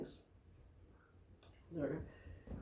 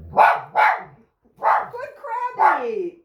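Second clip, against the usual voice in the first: very high, loud dog praise; thrilled praise after the fetch; excited praise; 9 dB louder, much slower than usual. Real, not acted.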